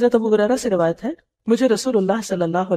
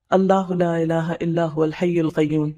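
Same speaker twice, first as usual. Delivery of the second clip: slow chanted recitation of the verse; Quranic recitation; 3 semitones lower, slower than usual. Shown as a demonstration. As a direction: intone it slowly, like tilawat. Prophet's answer, recited verse.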